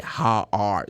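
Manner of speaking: "are" pronounced fully after "How"; separate, unreduced "How are"; full ARE. Shown as a demonstration. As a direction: give "are" its own full vowel and R. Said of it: "They don't say".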